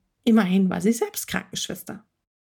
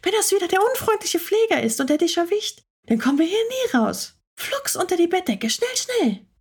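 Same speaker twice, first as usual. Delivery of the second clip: put-on much higher, urgent, hurried girl's voice; agitated urging; 10 semitones higher than usual. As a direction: rush the cat under the covers in a panic. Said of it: "flüsterte Maya ganz aufgeregt". (aufgeregtes Drängen, viel höher)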